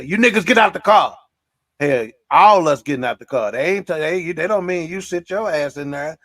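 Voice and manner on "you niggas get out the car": barking cop order, louder and higher; mock cop shout. Podcast host voicing a cop ordering men out of the car, mock shout. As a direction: bark it like a cop at a traffic stop. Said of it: "if they stop us and say".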